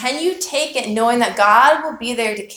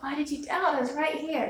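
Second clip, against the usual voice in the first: higher and much softer, like gentle reassurance; tender Jesus; 6 semitones higher, 9 dB quieter than usual. Retold or imitated. Jesus catching sinking Peter, softly and tenderly reassuring him. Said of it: "He's like".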